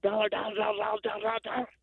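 a lightly voiced wheezy dowager whisper; whiskey whisper. A narrator's reading. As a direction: pant it out like an old rasping dowager. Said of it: "in a whiskey and emphysema whisper of an Ed-, Edith Wharton dowager".

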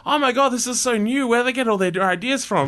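put-on breathless, amazed young fan; awed excitement. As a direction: gush like a kid discovering music. Kid hearing new bands, awed.

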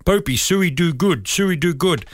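a hint of a proud, smiling prop; lightly voiced Samoan teammate. Proud prop reports back to his mentor, lightly imitated. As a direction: imitate simple broken English with pleased pride. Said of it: "came up to me with a big smile".

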